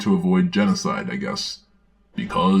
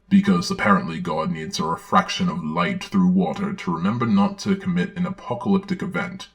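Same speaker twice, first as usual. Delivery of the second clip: normal talking voice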